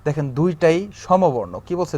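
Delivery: usual speaking voice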